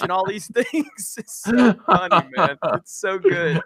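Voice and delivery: high voice breaking into laughter; helplessly amused, words squeezed by laughing. Real, not acted. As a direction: talk through real laughter. Laughing at his own retelling.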